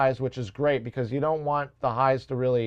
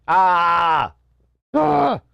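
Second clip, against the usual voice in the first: frustrated shout; 4 semitones higher, 6 dB louder than usual. Real, not acted. Frustrated outburst.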